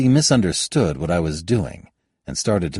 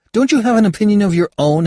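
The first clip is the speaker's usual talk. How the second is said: exasperated; 8 dB louder, faster than usual. Acted. Exasperated challenge to Hal.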